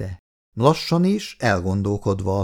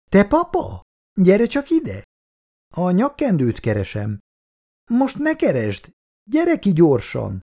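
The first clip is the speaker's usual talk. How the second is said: higher calls from the kitchen; urgent calling out; 9 semitones higher than usual. Acted. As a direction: call papa in urgently. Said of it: "felcsattant Szabó néni hangja". (a konyhából felcsattanó, sürgető hívás)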